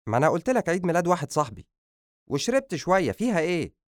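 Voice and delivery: high, fast protest; defensive son. Acted. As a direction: argue back as if it is no big deal. Drunk son argues with father.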